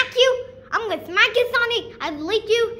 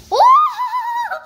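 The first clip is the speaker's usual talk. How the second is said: loud, very high drawn-out "oh"; high whoop; 6 dB louder than usual. Real, not acted. Child watching the balloon go, high loud whoop. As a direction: let out a long shrill cry.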